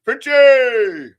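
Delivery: loud, high drawn-out holler; hyped shout-out. Real, not acted. Two hosts hail Finchy, hyped-up shouting. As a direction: holler it like a hype man.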